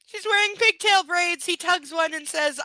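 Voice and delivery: high, loud disbelief; incredulous rant. Real, not acted. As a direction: spell out the absurdity, aghast. Incredulous recap.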